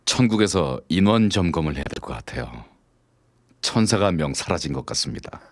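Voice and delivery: a slick, mock-tender line; cheesy pickup line. Man delivering a greasy pickup line to a woman.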